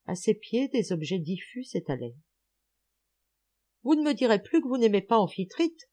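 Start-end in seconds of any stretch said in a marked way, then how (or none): 3.8-5.8 playful teasing call, a touch louder; light mock banter at the night sea. A narrator's reading. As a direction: tease him lightly, half joking. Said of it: "sur un ton de badinage".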